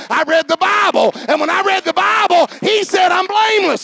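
building preacher's shout; rising proclamation. Acted.

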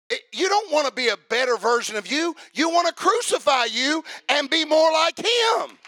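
high, loud, incredulous preaching; preaching at full force. Acted. Preacher challenging the crowd, loud and incredulous. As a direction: hammer the point home to the congregation. Preacher rebukes a baptism motive.

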